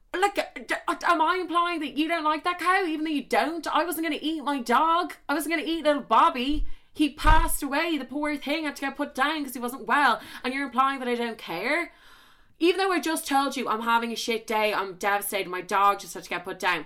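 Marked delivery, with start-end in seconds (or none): 0.1-11.9 high, heated indignant rant; furious about the dead dog; outraged rant. Real, not acted. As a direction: rant at him, wound up and high. Ranting about her dog.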